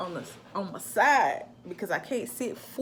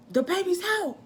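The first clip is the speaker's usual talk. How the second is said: higher, urgent mock yell; retold yell at Trayvon; 11 semitones higher, 3 dB louder than usual. Wife yelling at husband while driving, alarmed. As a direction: call it out in alarm, pitched up. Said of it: "I scream at Trayvon".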